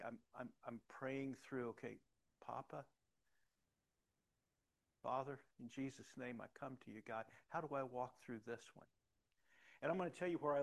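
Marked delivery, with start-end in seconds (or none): 1.7-8.9 softer, hushed prayer to God. Retold or imitated. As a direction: pray it quietly and intimately. Praying through hard days.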